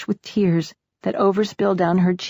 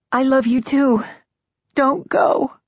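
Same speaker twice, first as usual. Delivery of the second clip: pleading; 8 semitones higher, 3 dB louder, slower than usual. A narrator's reading. Widow begs dead husband to stay.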